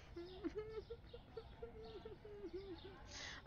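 soft incredulous laugh; quiet helpless chuckle; exasperated laugh. Real, not acted. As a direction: laugh in disbelief. Laughing at situation.

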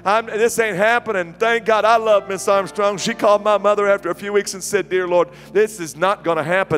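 animated preacher storytelling. Real, not acted. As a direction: project the tale with mounting comic alarm.

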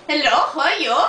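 put-on Russian accent, low voice. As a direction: play a Russian girl named Olga. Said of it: "Why do you have Russian accent".

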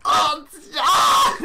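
high, loud cry out; startled yell. Real, not acted. Player shot from range, high startled yell. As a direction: yelp loudly as you get hit. Getting hit in the game.

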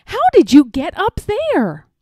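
high, loud amazed question; astonished. A narrator's reading. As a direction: ask in sheer amazement. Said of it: "gasped Elmer".

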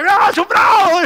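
very high, very loud, slow cheer; enthusiastic shout. Acted. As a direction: roar it from the crowd. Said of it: "y dice".

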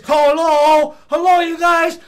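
put-on high, loud call to hidden kids. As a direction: call them out, drawn out. Fat Boy calling out.

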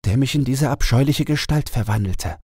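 the enchanted owl telling her sad tale; owl princess voice. A narrator's reading. Owl princess tells her story. (traurige Eulenprinzessin, etwas höher)

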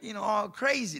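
usual speaking voice

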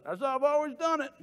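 mock the stubborn old-timer in a Southern accent; lightly hammed Southern old-timer; Southern drawl. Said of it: "lazy man syndrome".

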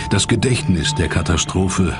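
normal talking voice

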